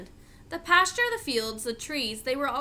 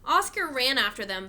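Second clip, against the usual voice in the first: ordinary delivery, nothing marked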